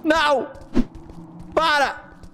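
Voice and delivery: high, panicked yelling; panicked screaming. Real, not acted. Caught by the old man.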